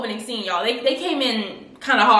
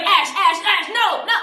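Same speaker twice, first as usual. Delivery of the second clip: high, urgent imitation of calling a name; mock panicked plea; 8 semitones higher than usual. Fez begging Ash to stop, high mock panic. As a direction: pitch it up, pleading him to stop. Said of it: "he was like".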